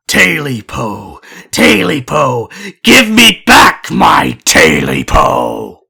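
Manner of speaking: slow, drawn-out eerie creature voice, ending in a shout; eerie creature voice demanding its tail back. Acted. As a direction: moan then shout for the tail.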